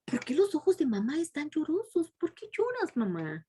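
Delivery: put-on high, puzzled questions like a waking boy; confused young man. Son asks his weeping mother why, high and puzzled. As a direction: plead up high, not understanding the tears. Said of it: "se preguntaba".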